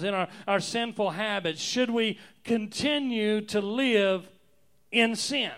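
climbing pulpit intensity; building sermon. Acted. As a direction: press the question harder and harder like a preacher. Preacher building to full force.

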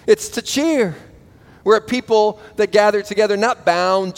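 rally the congregation to praise; rousing preaching. Real, not acted. Preacher urging Christians to cheer their Lord, rousing.

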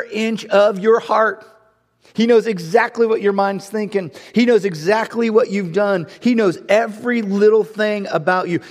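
fiery pulpit declaration; full-force preaching. Acted. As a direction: build it up like a preacher at the peak.

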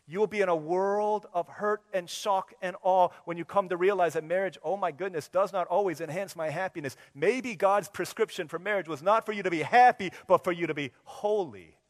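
fervent preaching. Acted. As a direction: preach it with fire. Preaching at full force.

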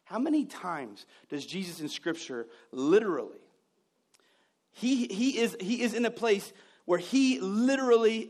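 ordinary talk, nothing marked

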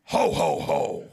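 very low, booming ho ho ho; Green Giant laugh. Retold or imitated. Preacher jokes Goliath laughed like the Green Giant, deep booming laugh. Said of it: "Green giant".

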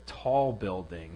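slow, low, dry repeat of tall building; wry echo. Retold or imitated. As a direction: echo her words slowly, amused. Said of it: "You see that tall building?".

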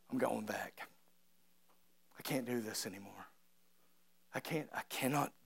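very soft, low acted resignation; defeated Peter. Condemned Peter quitting after failing Jesus, quiet defeat. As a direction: drop right down, as if giving up. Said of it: "So what does Peter say?".

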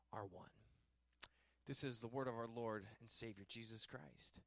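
solemn reading that softens to the close; scripture reading, fading out. Acted. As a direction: let the reading sink to a hush.

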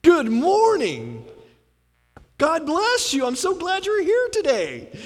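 high, slow, syrupy "Good morning"; the person you can't stand greeting you on Sunday, sickly sweet; sugary churchgoer greeting. Pretend. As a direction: gush a fake-sweet welcome at the door. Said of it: "Satan is gonna make sure that person is there every Sunday to say hi".

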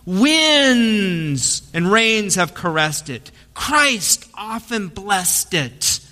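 slow, reverent recitation; slow, tender poem recital; heartfelt poem reading. Retold or imitated. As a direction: read it like a humble baker's prayer to bread. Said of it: "here's what he said when he read the poem".

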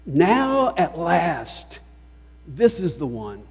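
slow, marvelling "Now at last, this is the one"; delighted and drawn out; joyous astonishment. Shown as a demonstration. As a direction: let the joy of finally finding her show. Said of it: "expression of joyous astonishment".

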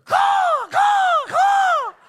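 loud high crow calls; crow caw. Retold or imitated. Preacher answering the neighborhood crows, loud cawing. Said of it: "And I'll just answer".

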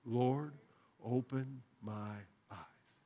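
slow, fading to a hush; hushed prayerful plea; soft slow prayer. Shown as a demonstration. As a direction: breathe the words as a prayer. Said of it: "Would you say that with me?".

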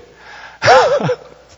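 loud, high burst of laughter at his own joke; big laugh. Real, not acted. Preacher to congregation, laughing hard at his own joke. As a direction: let a real laugh break out.

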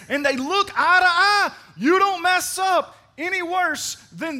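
high, loud impassioned preaching; preaching at full force. Acted. Pastor pleading with the congregation, high and loud. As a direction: drive it home to the crowd.

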